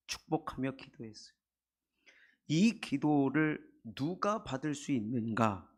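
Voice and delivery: usual speaking voice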